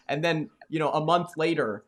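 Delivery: usual speaking voice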